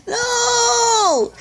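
high, wailing cry of defeat; anguished wail. Real, not acted. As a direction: cry out in loss, half laughing. Losing the game, crying out.

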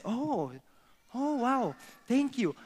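high, cooing mock amazement; father rabbit. Father rabbit thanking his little one, high and amazed. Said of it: "And the father says".

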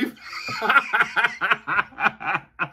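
high, helpless giggling burst; laughing. Real, not acted. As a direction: break down laughing mid-phrase. Cracks up mid-phrase.

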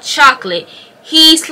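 ordinary talk

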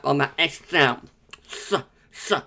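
"S" as a soft, lisping hiss after tongue surgery; "s" hissed quietly and unclearly after tongue surgery. Shown as a demonstration. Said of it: "it's definitely on that S here".

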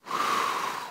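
a soft, unvoiced, tired sigh; weary sigh. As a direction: breathe out your frustration. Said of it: "he sighed deeply".

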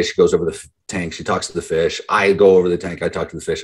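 normal talking voice

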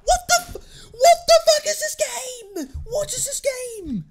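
very high, loud, slow half-laughing half-crying shout; real exasperated scream with a cracked laugh; laughing rage. Real, not acted. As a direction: lose it completely at the game.

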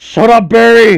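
loud annoyed shout; yelling. Real, not acted. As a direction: snap back loudly at him. Yelling at teasing friend.

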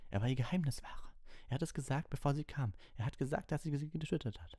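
Harry refusing to believe it; distraught protest. Acted. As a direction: insist through rising panic.

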